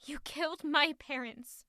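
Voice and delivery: quietly hurt. Acted. Accusation in quarrel.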